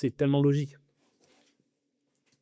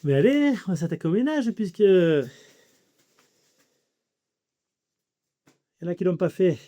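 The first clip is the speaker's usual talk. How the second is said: excited; 5 semitones higher, 5 dB louder than usual. Real, not acted. Rallying himself to start cleaning.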